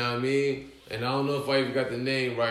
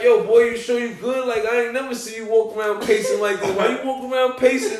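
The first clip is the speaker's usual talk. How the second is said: higher, louder retold questioning; mock disbelief; 7 semitones higher, 5 dB louder than usual. Friend questioning the pacing host, imitated disbelief.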